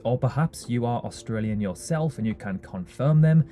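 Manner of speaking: ordinary talk, nothing marked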